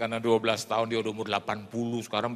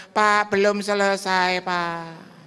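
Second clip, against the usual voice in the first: put-on high, slow old lady voice; lightly voiced elderly widow; old woman caller; 8 semitones higher, 5 dB louder, much slower than usual. Old widow phoning the pastor, high and drawn out.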